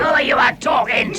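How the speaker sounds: angry troll retort. Acted. Troll rounding on the others, loud and indignant. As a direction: bristle and shout back. Trolls quarrelling among themselves.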